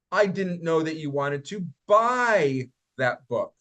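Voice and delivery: buy hit hard then falls, slow; stress on buy. Shown as a demonstration. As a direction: land on buy. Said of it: "listen to how I say these".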